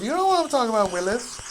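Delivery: catchphrase called out high and loud, "what" on top; playful loud call. Pretend. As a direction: shout it to Willis like a running joke. Repeats catchphrase, second one bigger.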